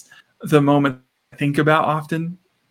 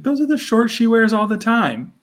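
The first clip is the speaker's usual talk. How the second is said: higher-pitched retold objection; protesting; 8 semitones higher than usual. Parents arguing over daughter's shorts, protesting. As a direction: imitate an exasperated parent arguing back.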